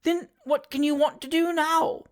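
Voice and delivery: put-on high old lady's voice, questioning; old lady gaining courage. A narrator's reading. Old lady questioning the fat boy, a little braver. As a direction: ask as a nervous old woman plucking up courage. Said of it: "gaining courage".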